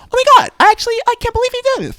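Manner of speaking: very high, fast camp squeal. Retold or imitated. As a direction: gush in an exaggerated falsetto. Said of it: "Do you think they fake the voice".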